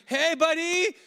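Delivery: loud hail across a room; calling out. Retold or imitated. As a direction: call out to a man walking away. Calling after the stranger.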